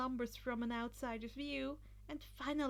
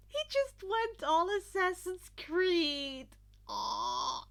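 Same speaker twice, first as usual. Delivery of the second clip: louder, slow fangirl gush; gushing squeal; 6 semitones higher, 5 dB louder, slower than usual. Real, not acted. Reader gushing over the hero's stunt, excited.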